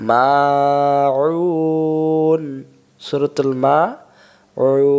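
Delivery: মাউন with the vowel held very long; three-alif stretch. Shown as a demonstration. Teacher demonstrating the long vowel in মাউন. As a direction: draw out মাউন for three beats. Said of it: "তাহলে এর উচ্চারণটা হলো কি".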